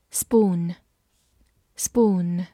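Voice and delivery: "Spoon" said carefully, twice; model pronunciation. Shown as a demonstration. Teacher modelling vocabulary for learners. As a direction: clear model reading of "spoon". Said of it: "vocabulary model reading".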